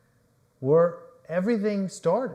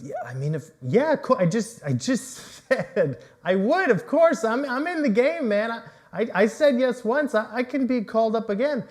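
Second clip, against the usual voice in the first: higher, louder, rushing, stammering and exasperated; frustrated Peter; 7 semitones higher, 6 dB louder than usual. Acted. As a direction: protest as if he's already said yes. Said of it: "you can hear Peter's not only frustrated".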